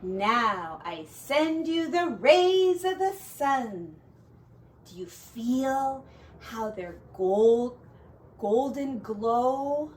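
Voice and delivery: slow, gentle storyteller's spell; slow soothing. Acted. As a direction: cast warmth over the listeners slowly. Frederick soothing the mice.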